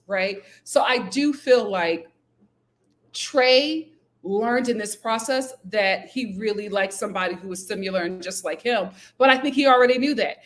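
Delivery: ordinary talk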